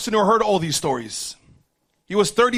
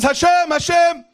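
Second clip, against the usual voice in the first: loud, slow, higher pleading call; pleading cry to Hashem; 6 semitones higher, 4 dB louder than usual. Acted. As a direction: call out to God, slow and urgent. Demon crying out to Hashem.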